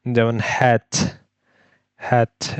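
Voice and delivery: হ্যাট with a clear breathy h; teacher modelling হ্যাট; h sound demo. Shown as a demonstration. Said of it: "এটা হলো হ sound".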